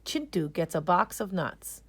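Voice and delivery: usual speaking voice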